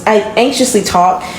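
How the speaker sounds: ordinary talk, nothing marked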